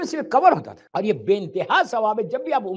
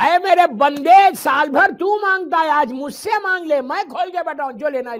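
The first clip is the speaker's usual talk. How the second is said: God calling out; 6 semitones higher, 7 dB louder than usual. Acted. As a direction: proclaim it like a divine summons. Voicing God calling servant.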